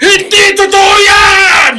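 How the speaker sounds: high, loud, slow anguished shout; crying shout. Acted. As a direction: scream the denial through tears. Father refuses the painful truth. (umiiyak na sigaw ng pagtanggi)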